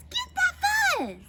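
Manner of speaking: high, loud yell; frantic battle cry. A narrator's reading. Prairie dog urges the fight on, frantic yell. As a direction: shriek the command at full pitch.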